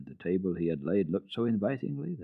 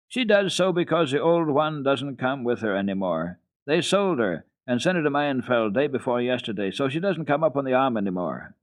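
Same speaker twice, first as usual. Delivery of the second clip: put-on higher, louder boyish explanation; boy calling out; 5 semitones higher, 4 dB louder than usual. A narrator's reading. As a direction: goat boy explains from where he sits. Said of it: "Peter called out".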